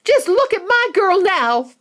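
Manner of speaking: high, loud outraged mother; distraught mother. Acted. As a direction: demand everyone look. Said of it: "cried Missus Beauregard".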